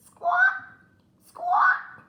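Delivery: high squawking hen cry. Acted. Jack hears the magic hen squawk loudly. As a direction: screech it like a startled hen.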